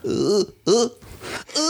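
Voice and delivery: short guttural grunts; hammed-up grunting; mock sex grunts. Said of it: "My signature sound?".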